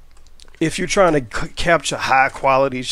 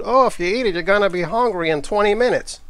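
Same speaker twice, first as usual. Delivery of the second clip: higher, put-on voice of common wisdom; high-pitched mock hearsay; mock quote; 6 semitones higher than usual. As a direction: recite an old myth in a mocking lilt. Acted quote of an old myth.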